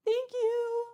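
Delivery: very high little voice; squeaky thanks. Real, not acted. Streamer thanking a viewer for a gift, high squeak.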